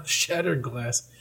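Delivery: ordinary delivery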